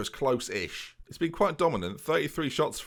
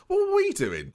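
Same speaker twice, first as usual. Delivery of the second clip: incredulous; 3 dB louder than usual. Real, not acted. Shocked at rival scorelines.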